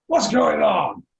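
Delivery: big acted outburst at a commotion; blustering tavern man. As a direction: call out indignantly to the crowd. Man from tavern demands explanation.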